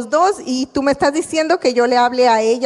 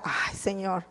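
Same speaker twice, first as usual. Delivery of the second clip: soft, reluctant groan to the Lord; softer mock-whiny complaint; reluctant sigh; 5 semitones lower, 14 dB quieter, slower than usual. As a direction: sigh it out, unwilling. Whining reply to the Lord.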